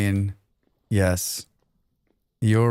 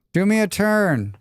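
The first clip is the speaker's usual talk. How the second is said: pleading; 8 semitones higher than usual. Real, not acted. Pleads again, higher.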